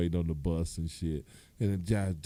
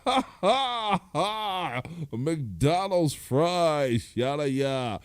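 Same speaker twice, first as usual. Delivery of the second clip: mock laughing; 8 semitones higher, 5 dB louder, much slower than usual. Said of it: "I was in the break room like".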